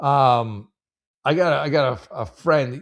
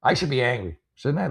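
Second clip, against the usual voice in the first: ordinary talk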